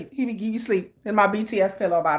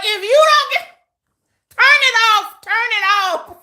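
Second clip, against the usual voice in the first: mock scolding; 10 semitones higher, 5 dB louder than usual. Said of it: "I'm like".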